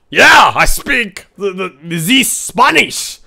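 hammed-up Spanish accent, slow and swooping; mock Spanish accent. Streamer proving they speak Spanish, hammed-up Spanish accent. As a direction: show off with an exaggerated Spanish accent.

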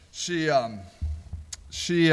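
normal talking voice

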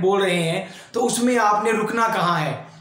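ordinary talk, nothing marked

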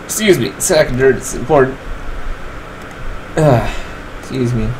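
normal talking voice

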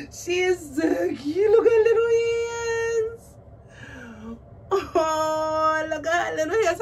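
loud, high gushing coo; high-pitched squealing. Real, not acted. Seeing the doll's little hands, squealing delight. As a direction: squeal over tiny cute details. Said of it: "my voice going up an octave".

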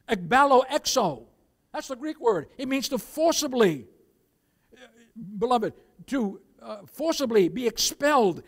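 slow, booming pulpit emphasis; preach. Real, not acted. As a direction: slam each key phrase as a warning. Preaches at full force.

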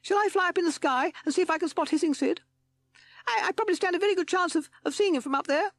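very high, loud little bat voice; high squeaky bat. A narrator's reading. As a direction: squeak it out anxiously. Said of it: "spoke in his high, squeaky voice".

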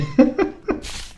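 airy high-pitched laugh. Real, not acted. Appears on the TV.

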